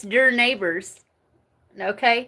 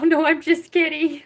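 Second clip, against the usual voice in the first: laughing while taking it back; 6 semitones higher, 4 dB louder than usual. Real, not acted. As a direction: giggle through the words. Laughs off her joke.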